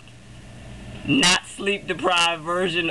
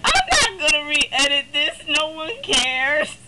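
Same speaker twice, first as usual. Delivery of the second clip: laughing through the words, a little louder; 6 semitones higher, 3 dB louder than usual. Real, not acted. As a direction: shrug it off while laughing. Laughing off recording mistake.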